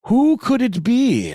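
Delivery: high theatrical question. Acted. Voicing poem speaker.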